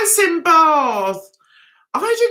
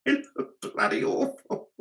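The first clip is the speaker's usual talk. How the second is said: whispered aside; 8 dB quieter than usual. Real, not acted. Laughing aside about a bad fringe.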